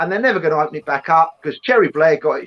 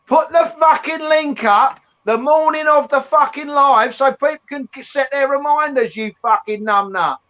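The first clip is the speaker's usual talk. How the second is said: scolding; 7 semitones higher than usual. Acted. Scolding co-host about links.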